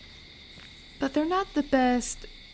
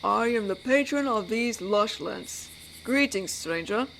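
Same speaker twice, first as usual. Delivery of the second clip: a hint of pompous lord of the forest; mock-grand patron. Werewolf jokingly welcomes a stranger to his lands, lightly mock-grand. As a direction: lightly put on a grand, formal greeting.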